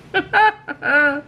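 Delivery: a real burst of laughter; amused laugh. Real, not acted. Owning up to missing the warning, amused laugh.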